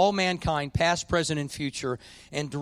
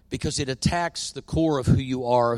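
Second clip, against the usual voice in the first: ordinary talk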